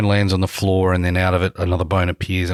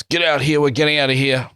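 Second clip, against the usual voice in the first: yelling out; 7 semitones higher than usual. Acted. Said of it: "Flopsy will yell out to Miles".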